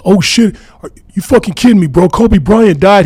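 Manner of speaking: loud, high, fast shocked outburst; retold yell. Man yelling the news to his buddy on the phone, high shocked yell. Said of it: "he yells".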